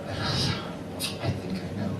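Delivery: very soft, slow, almost voiceless; near whisper. Acted. Customer timidly answers his barber, slow near whisper. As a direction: answer meekly under the breath.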